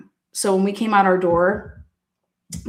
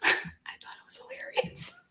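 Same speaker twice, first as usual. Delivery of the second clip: soft whispered aside through a laugh; 17 dB quieter than usual. Real, not acted. Admitting the deer nuts prank was funny, whispered. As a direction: breathe it as a secret.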